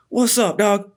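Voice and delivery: high-pitched mock challenge; put-on high voice of a heckler; retold taunt. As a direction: imitate a cocky attacker squaring up. Voicing attacker taunting him.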